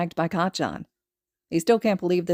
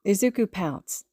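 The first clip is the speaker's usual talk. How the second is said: plain reading voice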